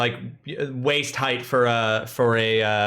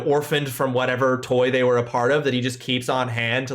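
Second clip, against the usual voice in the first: normal talking voice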